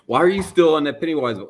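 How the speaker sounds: mock a baffled partner's complaint; imitated exasperation. Wife questioning husband's clown voice, imitated exasperation. Wife scolds him in retold exchange.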